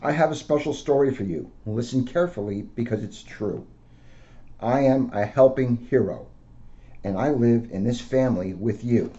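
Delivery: gentle storybook read-aloud; a police officer reading to children. Acted. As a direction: read it out to kids like a picture book. Officer reads picture book aloud.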